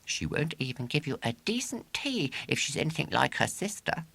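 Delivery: scornful warning; Ginger's voice. A narrator's reading. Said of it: "said Ginger".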